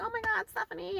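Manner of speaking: imitated gushing greeting, high and soft; delighted greeting. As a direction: greet her in a high, softened squeal. Manager greeting her, acted out.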